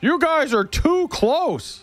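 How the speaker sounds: high, drawn-out holler, no real volume; imitating an irate man screaming from shore; mock scream. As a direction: holler as if across water, held back in volume. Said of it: "he starts screaming at us".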